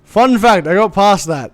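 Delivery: ordinary talk, nothing marked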